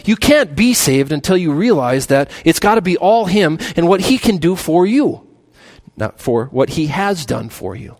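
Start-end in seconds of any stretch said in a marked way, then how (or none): none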